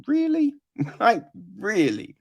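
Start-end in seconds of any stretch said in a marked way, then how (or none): 0.1-0.5 incredulous. Retold or imitated. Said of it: "you're just like".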